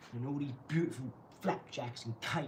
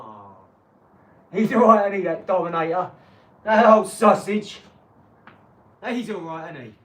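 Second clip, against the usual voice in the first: mock fond chat; 6 semitones higher, 19 dB louder than usual. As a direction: fake-friendly gossip shouted. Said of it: "We go".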